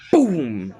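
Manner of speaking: high, drawn-out mock blast noise; imitated explosion boom. Narrator sounds out the punch that parts the clouds, imitated boom. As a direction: stretch it into a big impact sound.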